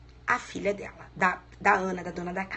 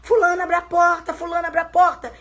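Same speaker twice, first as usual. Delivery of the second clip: high, loud repeated yell for the maid; shouting a name; 6 semitones higher, 6 dB louder than usual. Retold or imitated. Mother yelling for the maid to open the door, loud. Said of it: "começa a gritar pelo nome da empregada".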